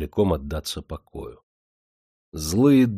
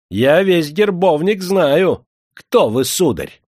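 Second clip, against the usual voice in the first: high, loud, haughty slow insistence; persistent challenge; 8 semitones higher, 6 dB louder, slower than usual. A narrator's reading. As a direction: challenge his rank indignantly. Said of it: "не унимался проезжий". (визгливо, настойчиво и спесиво)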